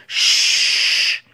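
long, loud shush; cautioning shush. A narrator's reading. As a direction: shush them urgently. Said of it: "he cautioned".

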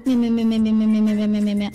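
long, rapid, low run of "meh" sounds; imitated gulping noise. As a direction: guzzle it down in pretend gulps. Acts out drinking up the milk. (模仿咕嘟咕嘟喝奶的声音)

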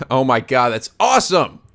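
usual speaking voice

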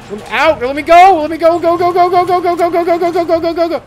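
high, loud, frantic chanting; panicked escape. Real, not acted. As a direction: shout it over and over to flee. Fleeing the monster.

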